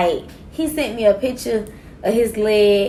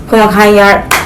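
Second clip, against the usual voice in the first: ordinary talk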